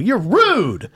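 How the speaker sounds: high-pitched mock reproach; playful, drawn-out telling-off; shaming. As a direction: scold them with a big swoop up. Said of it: "That's what I tell people".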